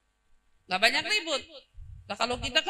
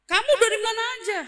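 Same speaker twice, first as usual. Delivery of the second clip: angry boss; 8 semitones higher, 4 dB louder than usual. Acted. Boss angrily questioning worker.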